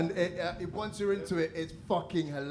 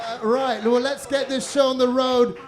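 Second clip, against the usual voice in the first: loud, high stage intro; announcer voice; 8 semitones higher, 9 dB louder than usual. Real, not acted. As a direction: hype the crowd for the act. Host introducing next act.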